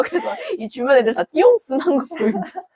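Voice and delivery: ordinary delivery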